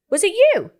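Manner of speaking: high, loud retold question; acted incredulous accusation. Partner questioning old man about roof incident, acted incredulous accusation. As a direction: pounce on him in disbelief.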